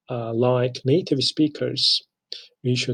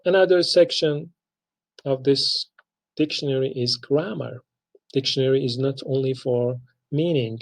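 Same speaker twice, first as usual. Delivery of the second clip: ordinary talk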